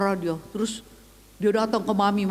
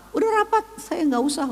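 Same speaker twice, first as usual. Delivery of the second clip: menolak; 7 semitones higher, faster than usual. Retold or imitated. Menolak diantar, percakapan diperagakan.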